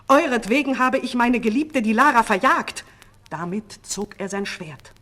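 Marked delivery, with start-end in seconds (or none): 0.1-2.9 high, loud reproach; angry outcry. A narrator's reading. King blaming sorcerers for his lost beloved, angry. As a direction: cry out the bitter accusation. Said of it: "rief der König".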